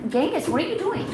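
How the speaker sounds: high, rising call to the cat; pet-talk, half alarmed, half amused; surprised scolding. Real, not acted. Streamer calling out to the cat mid-sentence, surprised and rising.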